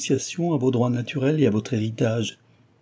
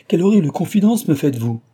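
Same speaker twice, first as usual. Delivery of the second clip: higher, louder cry of dismay; horrified exclamation; 7 semitones higher, 6 dB louder than usual. A narrator's reading. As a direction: recoil aloud from the news. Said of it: "s'écria Delville".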